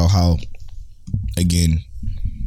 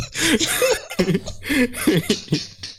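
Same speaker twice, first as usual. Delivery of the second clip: high, breathy fit of laughing; helpless laughter; 12 semitones higher, 6 dB quieter than usual. Real, not acted. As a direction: keep laughing, out of control. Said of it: "You okay?".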